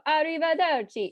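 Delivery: high, loud Italian-accented flourish. Shown as a demonstration. Storyteller showing the audience an accent on request, Italian accent. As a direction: belt it out in a showy Italian accent. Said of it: "Please give me an Italian accent".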